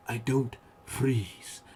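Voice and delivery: put-on slow, lightly done Wakandan accent; a hint of Wakandan accent, slowed right down. Praising the actor, a slow light Wakandan accent. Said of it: "just nails the accent".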